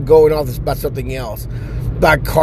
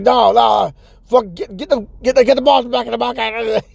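high, stammering mock yell; crazed game host; 9 semitones higher than usual. Grandpa insisting kids keep playing, frantic yell. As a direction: shout frantic orders in a strained high voice.

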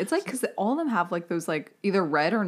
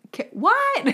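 high startled ghost. Pretend. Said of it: "she's like".

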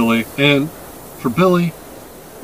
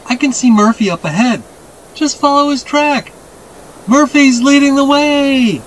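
put-on boy calling out; 13 semitones higher, 4 dB louder than usual. A narrator's reading. Boy guides the men through snow, calling out. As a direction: call ahead, stretching the last words.

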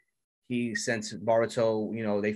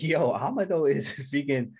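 laughing, louder and higher, over the plot twist; laughing reaction; 5 semitones higher, 5 dB louder than usual. Real, not acted. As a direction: blurt it out with a real laugh.

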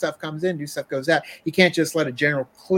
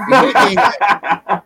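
laughing; 4 semitones higher, 9 dB louder, slower than usual. Real, not acted. Laughing emphatic agreement.

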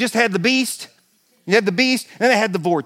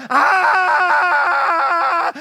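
terrified scream; 9 semitones higher than usual. Retold or imitated. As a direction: hold the scream the whole drop. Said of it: "like, going".

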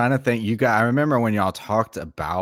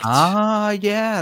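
surprised realisation; 12 semitones higher, 5 dB louder than usual. Real, not acted. Suddenly remembering the answer.

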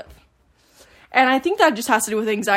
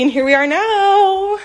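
out of breath; 8 semitones higher, slower than usual. Real, not acted. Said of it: "so out of breath".

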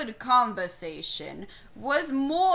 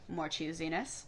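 ordinary delivery, nothing marked

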